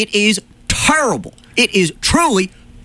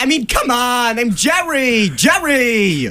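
higher, whiny George; George impression; 7 semitones higher, slower than usual. Host whines to Jerry in a higher George voice. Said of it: "And be like".

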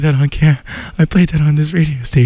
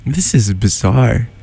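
ordinary delivery

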